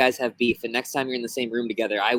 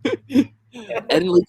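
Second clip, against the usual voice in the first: high laughing guess; 6 dB louder, slower than usual. Real, not acted. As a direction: blurt it out through laughter.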